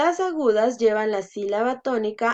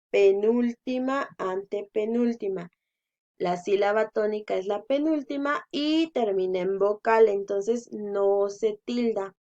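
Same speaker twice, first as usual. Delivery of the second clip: ordinary delivery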